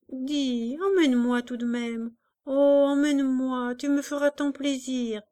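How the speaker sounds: put-on a child begging her mother to take her; a hint of a small girl pleading. A narrator's reading.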